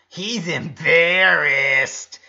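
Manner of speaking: loud, slow, gloating tease; wicked teasing. A narrator's reading. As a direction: needle him with a sly grin. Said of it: "with a wicked smile".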